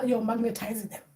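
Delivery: usual speaking voice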